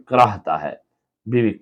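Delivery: usual speaking voice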